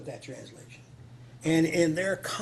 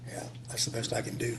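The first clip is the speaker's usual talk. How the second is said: quick whispered aside, softer; 4 semitones lower, 5 dB quieter, faster than usual. Real, not acted. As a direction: mutter it to yourself, fast. Self-deprecating aside after French.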